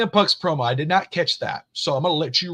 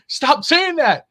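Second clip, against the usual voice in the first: put-on high, louder whiny kid; daughter's voice; 13 semitones higher, 6 dB louder, much slower than usual. Daughter telling mom to stop, in a high, whiny kid voice. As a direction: whine it like a fed-up child.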